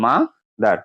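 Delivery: মাদার with a gap after মা; মাদার broken into its two syllables; split into syllables. Shown as a demonstration. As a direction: say মাদার as two separate beats, মা, দার. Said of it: "দুইটা syllable আছে".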